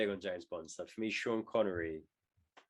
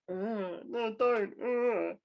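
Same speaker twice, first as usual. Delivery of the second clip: high-pitched, hammed-up coy refusal; mock sexualized protest; 10 semitones higher, much slower than usual. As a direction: draw out mock moans around a token protest. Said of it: "kind of sexualizing that".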